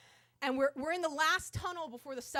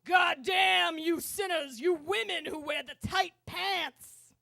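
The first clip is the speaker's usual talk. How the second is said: imitated subway preacher, loud and drawn out; fire-and-brimstone preacher, stretching the stressed words; booming sermon voice; 5 semitones higher, 7 dB louder than usual. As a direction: thunder slow damnation at the sinners. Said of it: "he tried another one".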